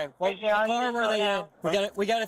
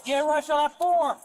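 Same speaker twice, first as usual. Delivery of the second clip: urgent shout; 8 semitones higher, 4 dB louder than usual. Real, not acted. Officer calls during CPR.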